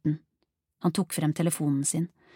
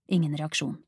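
plain reading voice

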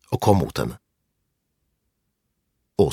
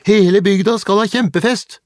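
put-on high, loud child's jeer; acted boy hollering, pitched way up; shouted taunt; 13 semitones higher, 6 dB louder than usual. A narrator's reading. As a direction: crow it out to rub it in.

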